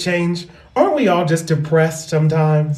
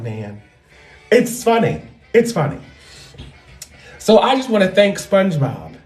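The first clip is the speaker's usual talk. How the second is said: ordinary delivery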